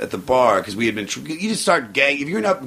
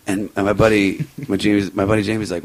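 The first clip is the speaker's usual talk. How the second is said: usual speaking voice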